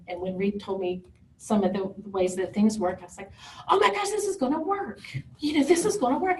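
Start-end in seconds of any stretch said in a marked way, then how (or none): 3.7-6.4 high-pitched burst of hope; excited realization. Retold or imitated. Mother hearing treatment could work, excited and high. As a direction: relive the thrill of a breakthrough. Retold sudden hope on learning options.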